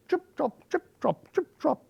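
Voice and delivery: very high, light little steps; small goat pattering across; tiny trip-trop hooves. A narrator's reading. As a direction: tap it out high and dainty. The smallest goat's hooves on the bridge.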